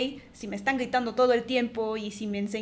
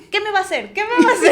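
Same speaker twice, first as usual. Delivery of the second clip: defiant mom; 7 semitones higher, 8 dB louder, faster than usual. Retold or imitated. Mom quoted, defiant boomer attitude.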